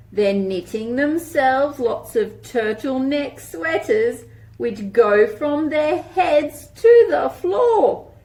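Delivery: lilting picture-book read-aloud; reading to children. Acted. Teacher reading a rhyming book to children, lilting. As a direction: read it like a storybook for little ones.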